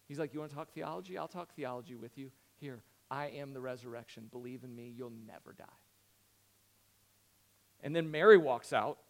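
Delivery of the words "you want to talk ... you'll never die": very soft, low retold reply; quietly meet them where they are; hushed, low-pitched comforting voice; gentle reassurance. Jesus gently answering Martha's theological need.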